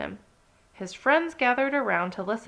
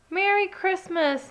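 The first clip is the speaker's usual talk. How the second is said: higher, louder happy call; 10 semitones higher, 3 dB louder than usual. Acted. Chipmunk greets Ted from afar, higher and louder. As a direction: call it across the snow. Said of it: "Nicky Chipmunk called".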